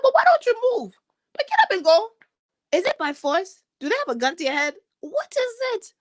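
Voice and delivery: high, incredulous challenge; exasperated retort. Retold or imitated. Speaker telling complainers to get up and go, exasperated.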